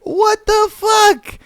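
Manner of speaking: high, slow, shouted shock; stunned disbelief. Real, not acted. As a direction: blurt it out in real disbelief. Reacting to the banana story.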